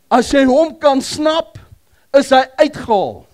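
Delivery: loud, slow punch of the point; slow loud sermon climax; loud slow proclamation. Acted. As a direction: hammer it home to the crowd.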